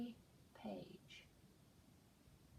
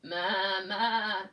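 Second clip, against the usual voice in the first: loud bleating "Ma, ma"; big playful goat bleat for the children; 11 dB louder than usual. Acted. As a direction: call it out like a goat. Goat bleats in picture book.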